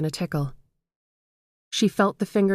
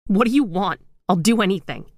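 desperate pleading; 2 semitones higher, 4 dB louder than usual. A narrator's reading. Gail begging the phantom.

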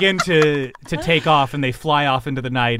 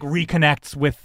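normal talking voice

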